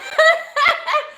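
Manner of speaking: high, loud burst of laughing; laughter. Real, not acted. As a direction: break into a laugh mid-plea. Laughing amid acted plea.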